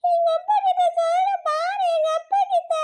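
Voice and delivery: high crying shout for appa; crying kid calling dad. Acted. Little girl sobbing and shouting for her dad. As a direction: bawl for daddy like a small child.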